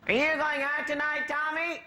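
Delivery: high mock Irish mammy; mammy quizzing her son, high and sing-song; mother voice.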